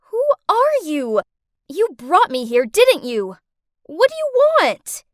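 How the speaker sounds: loud, high-pitched confrontation; alarmed demand. Acted. Captive confronts the man who brought her here, alarmed demand. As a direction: fire the questions at him, loud and accusing.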